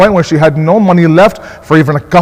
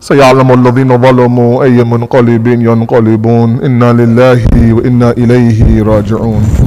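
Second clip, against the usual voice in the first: lower, quick chanted Arabic recitation; Quranic recitation; 6 semitones lower, faster than usual. Acted. Preacher closes the majlis with verse, chanted recitation. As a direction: intone the verse like a closing prayer.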